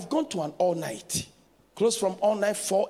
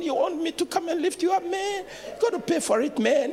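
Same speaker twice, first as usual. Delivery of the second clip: higher, fast, mocking street voice; mock New York passerby; 10 semitones higher than usual. A passerby mocks the fallen preacher, asking to be paid. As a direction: tease him about paying for help. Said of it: "And you know the American".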